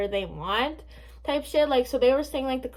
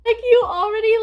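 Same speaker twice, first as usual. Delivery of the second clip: taunting outburst; 11 semitones higher, 9 dB louder than usual. Real, not acted. Mocking the stalker account.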